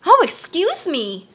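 high, drawn-out startled apology. A narrator's reading. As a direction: apologize as if caught off guard. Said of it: "hastily drawing back".

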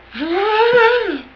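long high voiced squeal, rising then falling; hyped-up noise. Vlogger shows being hyped up on sugar, silly high squeal. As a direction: let out a silly high wail.

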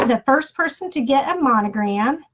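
ordinary talk

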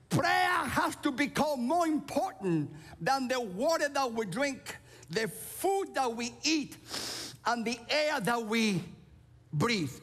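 full-force preaching. Acted. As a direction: preach it out, hammer each word.

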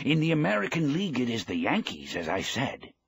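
light up talking about the Yankees; happily. A narrator's reading. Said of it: "the old man said happily".

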